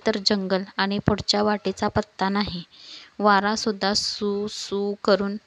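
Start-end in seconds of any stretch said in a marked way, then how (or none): 3.9-4.9 drawn-out, slow sound of wind; imitated wind whoosh. A narrator's reading. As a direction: stretch it out like blowing wind. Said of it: "आवाज करत होता".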